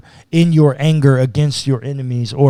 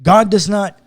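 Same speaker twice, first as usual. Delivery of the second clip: normal talking voice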